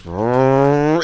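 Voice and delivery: rolling mock snore; comic rumbling snore noise; imitated snore. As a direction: imitate a driver snoring at the wheel. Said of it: "They snore at the lights".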